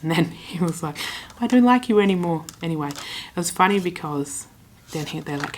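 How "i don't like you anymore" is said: loud, high, quick mock outburst; mock indignation. Hockey fan jokingly turns on a rival supporter, mock indignant. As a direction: blurt it out in playful offence. Retold mock falling-out over teams.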